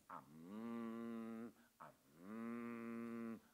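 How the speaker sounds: long, steady monotone "om" hum; slow, sustained mantra drone; meditation hum. Acted. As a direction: imitate a meditator chanting, each hum held long. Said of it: "O cara fica meditando".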